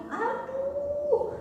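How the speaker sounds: high, drawn-out cry of woe; hammed-up wail, held long and high; acted wail. Storyteller voicing suffering Israelites crying out, acted wail. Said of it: "Orang Israel pada teriak-teriak".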